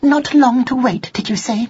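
high, icy echo of his words; high-pitched cold disbelief. A narrator's reading. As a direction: throw it back at him coldly, pitched high. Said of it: "in an icy voice".

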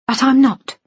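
high-pitched, cold rebuff. A narrator's reading. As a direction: cut your father off coldly. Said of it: "Jennifer said coldly".

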